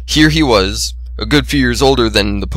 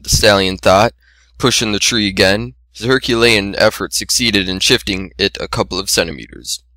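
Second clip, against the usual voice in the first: plain reading voice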